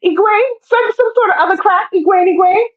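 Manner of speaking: high, sing-song cooing voice; mock sugary captor. Captor cooing at Egwene like a pet, high and sweet. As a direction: coo at her like a pet owner.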